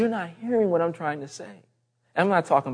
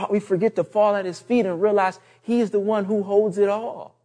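normal talking voice

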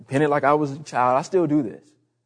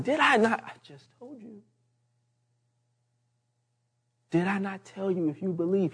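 high-pitched, incredulous reminder; exasperated Jesus; 5 semitones higher, 5 dB quieter than usual. Retold or imitated. Jesus reminding Martha of what he told her, exasperated. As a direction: repeat it in disbelief, pitch raised. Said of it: "He's like".

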